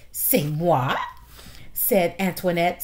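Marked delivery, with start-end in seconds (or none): 0.1-1.0 loud, offended French "C'est moi?"; indignant French mouse. Acted. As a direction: toss back "C'est moi?" in French outrage.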